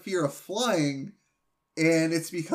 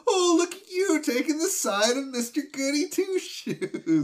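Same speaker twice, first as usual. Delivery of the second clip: much higher, laughing through a mock Homer whine; 9 semitones higher than usual. Homer mocking Marge for siding with Flanders, laughing high. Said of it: "And Homer's like".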